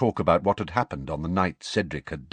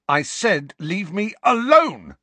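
much higher, louder, slow angry shout; 11 semitones higher, 5 dB louder, slower than usual. A narrator's reading. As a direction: yell at him to leave you alone. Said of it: "Harry shouted".

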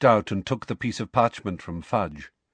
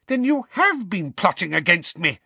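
high-pitched, mildly raised yell; yelled accusation; 9 semitones higher, 3 dB louder than usual. A narrator's reading. Minister accusing the headmaster of plotting, yelling. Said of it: "he yelled".

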